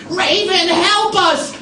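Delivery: very high, loud cry for help; panicked birds. Acted. Trapped birds crying to raven, high panicked scream.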